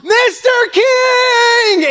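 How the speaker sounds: high, long desperate yell across the parking lot; yelled at top of lungs. Retold or imitated. Calling across a parking lot to Larry King, full-lung yell. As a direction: scream his name with everything you have. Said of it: "I yelled at the top of my lungs".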